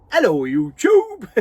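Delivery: high, loud, bright cheery hello; bright chirpy greeting. Retold or imitated. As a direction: greet the viewers like a chirpy geezer. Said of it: "Such a bright, chirpy geezer".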